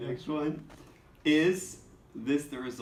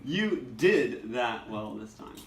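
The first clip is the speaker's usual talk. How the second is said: slow, with "did" drawn out and raised; stress on "did"; slower than usual. Shown as a demonstration. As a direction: lean long on "did". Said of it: "different inflection on the words".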